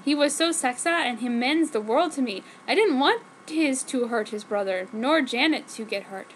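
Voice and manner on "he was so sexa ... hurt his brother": plain reading voice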